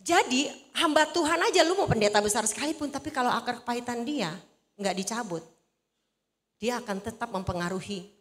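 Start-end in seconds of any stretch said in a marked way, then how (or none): none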